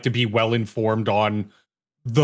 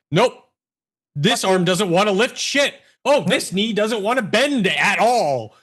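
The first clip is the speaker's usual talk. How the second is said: high, stubborn mock refusal; pitched-up, stroppy complaint; 8 semitones higher than usual. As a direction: act out a body part digging in. Voices uncooperative arm and knee.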